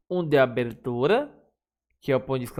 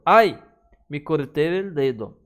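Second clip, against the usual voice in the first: cry of pain; 5 dB louder than usual. Shown as a demonstration. Teacher acting out pain for the class, mock ouch. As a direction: yelp as if you just cut your finger.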